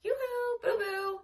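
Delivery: high sing-song call to the cat; yoo-hoo it high and bright across the yard. Acted. Babysitter calling the lost cat, high sing-song call.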